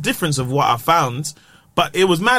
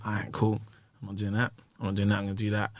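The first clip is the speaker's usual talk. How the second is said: quiet to himself; 7 semitones lower, 12 dB quieter, faster than usual. Retold or imitated. Inner talk to himself.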